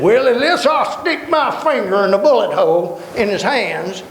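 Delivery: high, stubborn doubter's voice; doubting Thomas. Retold or imitated. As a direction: push back like a stubborn skeptic. Thomas demanding proof, quoted.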